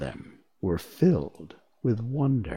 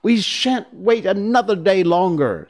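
insistent; 10 semitones higher, 6 dB louder than usual. Acted. Scarecrow insists.

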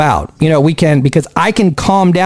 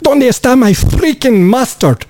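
put-on shouting old Mexican man; higher, demanding shout for mustard; angry old man; 8 semitones higher than usual. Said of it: "Take five".

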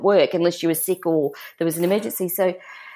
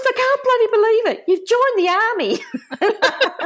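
high-pitched, outraged imitation of mum; mock scream. Mum has just opened daughter's army letter, mock-screaming. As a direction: shriek it as scandalised mum, without full volume. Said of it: "and she screamed".

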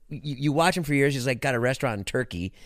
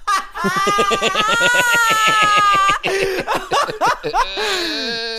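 high, loud squealing laughter; helpless wailing laugh that keeps going; long laughing fit; 7 dB louder than usual. Real, not acted. Bursts out laughing at the story.

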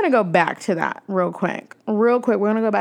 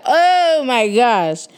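loud, high, drawn-out retold yell; long exclaimed yell, reenacted; yelled realisation; 5 semitones higher, 6 dB louder, much slower than usual. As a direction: cry out as if it just hit you. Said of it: "I'm sorry that I just yelled".

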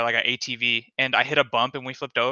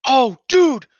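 sudden loud high "oh dude"; excited outburst; 11 semitones higher, 8 dB louder than usual. Real, not acted. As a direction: blurt it out all fired up.

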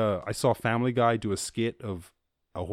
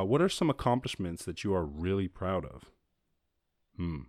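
normal talking voice

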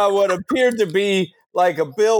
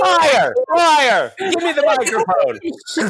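angry heckler shout; 7 semitones higher, slower than usual. Acted. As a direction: shout it out from the crowd. Audience member heckles.

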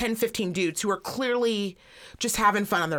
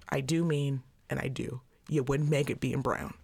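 measured put-down; 4 semitones lower than usual. Retold or imitated. Comedian recites her written reply to an offended commenter, coolly measured. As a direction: lay it down firmly, pausing around each point.

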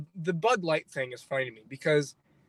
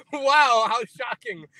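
sarcastic wow; 5 semitones higher, slower than usual. Acted. Mock shock at coming out Italian.

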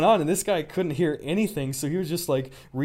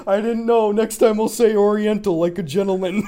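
mock apology; 6 semitones higher, 6 dB louder than usual. Mocking the teacher's apology.

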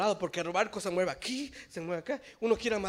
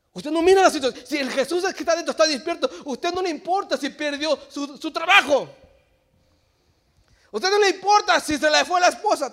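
loud, high, fast sermon delivery; fiery preaching; 9 semitones higher, 12 dB louder than usual. Acted. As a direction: press the point on the congregation. Preaching at full force.

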